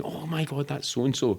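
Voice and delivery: imitating a fan meeting a celebrity; mock starstruck gasp. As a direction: gush like a starstruck partygoer. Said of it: "running about and asking".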